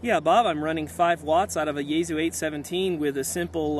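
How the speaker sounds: ordinary delivery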